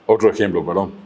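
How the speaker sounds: ordinary talk, nothing marked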